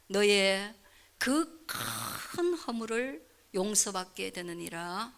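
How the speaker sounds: very slow, soft verse reading. Shown as a demonstration. Pastor slowly rereading the promise to the congregation. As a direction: let each word settle. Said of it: "어떻게 하라고요?".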